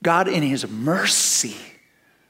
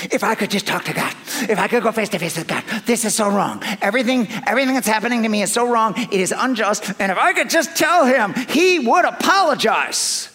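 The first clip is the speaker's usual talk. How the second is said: building indignant complaint; 6 semitones higher, 4 dB louder, faster than usual. Retold or imitated. Job complaining he was wronged.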